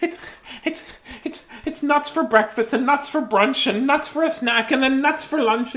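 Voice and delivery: high squirrel gone nuts, then fed up. Acted.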